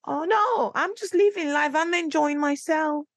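high, light, brushing it off; airy high voice of the bride; casually deflecting the question; lady's breezy reply. Retold or imitated. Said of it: "She went".

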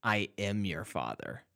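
low, quiet, ominous reveal; mock Darth Vader. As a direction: drop the voice low and hush it for the twist.

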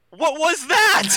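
loud, high-pitched disbelief; startled exclamation. Retold or imitated. Telling co-hosts her stunned reaction to the scene, startled exclamation. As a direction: blurt it out in mock shock.

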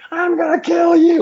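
hammed-up fury, voice jumping way up; mock fighter rage. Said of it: "you looked mad in that fight".

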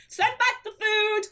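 high, loud, indignant command; mock outrage. Husband ordering the food away after the row, mock outraged command.

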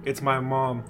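ordinary delivery

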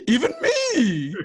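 high, long-stretched comic whine; Pee-wee-style Riley. Retold or imitated. Podcaster jokes in his Riley voice, high drawn-out wimpy squeal. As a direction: squeal it slowly through a laugh. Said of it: "He's Pee-wee".

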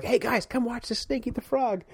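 put-on high-pitched, eager summons to kids; imitating an excited camp leader; retold excited call. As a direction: call the group over, pitched well up. Calling kids over to watch.

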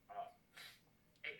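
usual speaking voice

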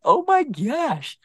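high, slow, stretched-out amazement; drawn out high in disbelief; awed gasp. Retold or imitated. Recalling the camouflaged cougar in the bush, slow high awe.

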